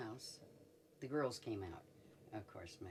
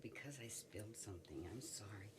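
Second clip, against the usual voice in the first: hushed apology; 6 dB quieter than usual. Retold or imitated. Covering up to suspicious patient.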